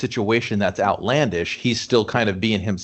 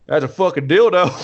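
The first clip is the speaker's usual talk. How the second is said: random bystander yelling, louder and higher; hammed-up shout from a random onlooker; mock background yell; 4 semitones higher, 5 dB louder than usual. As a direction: yell it like some heckler in the background. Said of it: "just somebody random just yelling".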